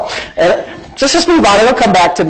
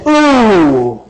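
mock impressed ooh; drawn-out sarcastic ooh. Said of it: "like real sarcastic".

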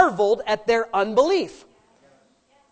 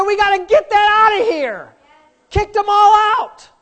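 higher and louder, shouting the command; loud preaching shout; 8 semitones higher, 8 dB louder than usual. Acted. Jesus clearing the house of doubters, a loud high shout. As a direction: throw the mourners out with your voice.

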